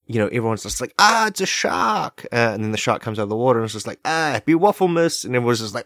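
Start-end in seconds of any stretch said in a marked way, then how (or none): 1.0-2.3 high, slow mock panicked shriek; mock scream. Beachgoers shrieking at the shark, hammed-up panic. 4.1-5.3 cheery shark. Retold or imitated. Shark cheerfully greets the beach.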